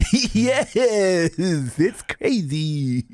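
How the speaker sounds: very high, stretched-out delight; Dylan from Love on the Spectrum. Pretend. Interviewer hears Dylan's reply, very high drawn-out delight. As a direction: squeal it slowly, thrilled. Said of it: "I'll answer it as Dylan".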